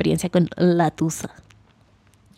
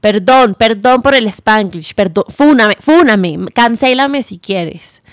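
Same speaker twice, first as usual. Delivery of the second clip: loud, playful over-apologizing; hammed-up sorry, cancel me; mock apology; 3 semitones higher, 7 dB louder than usual. Real, not acted. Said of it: "esto va a sonar súper farto". (disculpa exagerada y juguetona)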